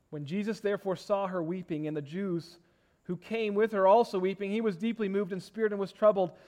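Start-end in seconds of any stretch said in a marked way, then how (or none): none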